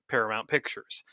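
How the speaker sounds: ordinary talk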